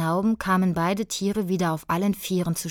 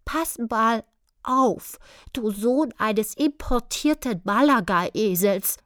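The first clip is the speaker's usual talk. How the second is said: höher, langsam durch die Zähne gepresst; zähneknirschend; 5 semitones higher, slower than usual. A narrator's reading. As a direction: presse es zähneknirschend heraus. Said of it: "sagte er zähneknirschend". (mit knirschenden Zähnen)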